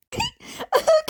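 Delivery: high bursting laugh. A narrator's reading. Izuku laughing at the abrupt comment.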